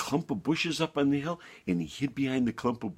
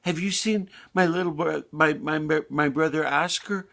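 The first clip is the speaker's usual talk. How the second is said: higher, lightly voiced young lamb; a hint of an anxious brother; worried lamb asking; 6 semitones higher than usual. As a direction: ask the shepherd in a raised voice. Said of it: "and he said".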